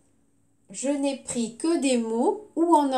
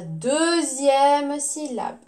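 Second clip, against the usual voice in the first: "deuxième" drawn out, pitched up; stress on DEUXIÈME; 3 semitones higher, much slower than usual. Shown as a demonstration. Teacher gives pupils the answer, stretching "deuxième". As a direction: lean slowly on "deuxième".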